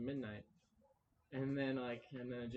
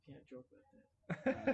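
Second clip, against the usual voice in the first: laughing; 5 dB louder, much slower than usual. Real, not acted. Laughs at own joke.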